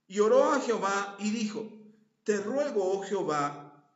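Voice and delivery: loud, pleading scripture prayer; fervent prayer reading. Acted. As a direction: plead it out at full force. Lee la oración de Ezequías. (oración leída con fervor)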